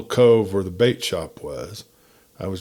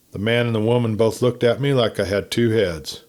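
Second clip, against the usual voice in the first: ordinary delivery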